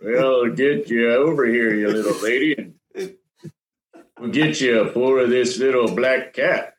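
friendly drawled western cowboy. Acted. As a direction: play a cowboy pouring a drink at the campfire. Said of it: "when he does his voices".

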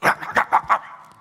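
loud mock chomping noises; biting noise. Preacher imitating grumpy person biting everybody, mock snapping noises.

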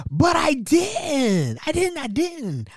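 slow, high, drawn-out "didn't"; comic high-pitched protest; protesting denial. Retold or imitated. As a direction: plead innocence, stretching each denial. Denying he planned to ask.

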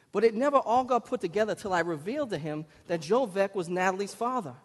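ordinary delivery

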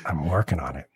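low, soft, evasive reply; retold as a quiet, stalling admission; hesitant bluff. New model asked who his agent is, hesitant bluff.

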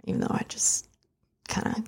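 whispered confession, half to herself; hushed aside. Real, not acted. Guest admitting a slip to the host, whispered. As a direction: drop to a whisper to let the secret slip.

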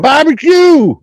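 high, loud holler; shouted. Acted. Shouting out the barbecue topic for the break, hollered high. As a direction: belt it out high and drawn out.

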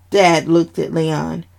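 plain reading voice

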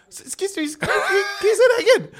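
high-pitched mock bewilderment, breaking into laughter; baffled mum. Mum asks son to repeat the lyric, high and baffled.

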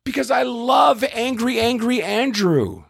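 a hammed-up gushing woman; mock gushing fan. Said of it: "And she says".

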